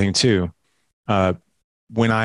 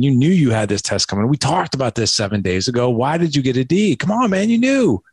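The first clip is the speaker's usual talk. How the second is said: frustrated dad lecture; 4 semitones higher than usual. Retold or imitated. Frustrated father scolding his son over a D, climbing higher at the end. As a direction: vent at the son for slacking. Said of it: "I just wanna be like".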